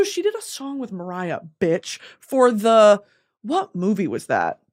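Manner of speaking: usual speaking voice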